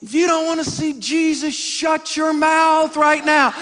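high, slow, stern warning; hammed-up angry dad threat; dad threatening his yelling son; mock threat. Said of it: "I'm like".

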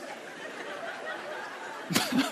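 high, airless laugh at himself; breathy laughter. Real, not acted. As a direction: burst out laughing at his own joke. Laughs after his own outburst.

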